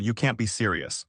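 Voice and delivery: ordinary delivery, nothing marked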